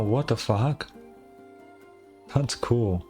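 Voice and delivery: ordinary talk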